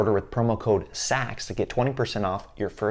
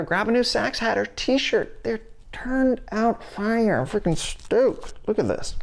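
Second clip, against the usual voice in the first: high silly voice; 12 semitones higher, slower than usual. Pretend. Ad read turns silly.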